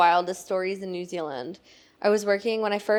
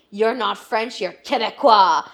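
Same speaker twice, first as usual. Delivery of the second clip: annoyed customer; 7 dB louder than usual. Retold or imitated. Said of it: "and he goes".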